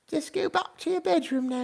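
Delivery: put-on high old British lady voice, tucking children in; sing-song granny British bedtime voice; old lady British accent. Old British lady saying goodnight to viewers, in a high old lady British accent. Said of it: "say goodnight in an old lady British accent".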